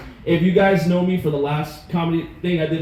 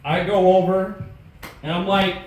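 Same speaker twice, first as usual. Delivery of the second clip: usual speaking voice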